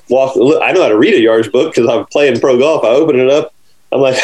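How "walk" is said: ordinary talk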